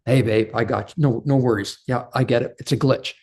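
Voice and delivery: soft, easy reassurance; soft forgiving husband accepting an apology. Retold or imitated. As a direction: let it go gently, no big deal. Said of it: "the masculine is typically very forgiving".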